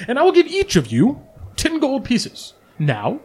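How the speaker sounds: salesman-keen reward offer; eager offer. Acted. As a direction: pitch lifted high, selling it. Volo offers a big reward.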